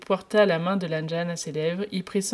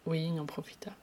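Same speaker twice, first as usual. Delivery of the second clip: ordinary delivery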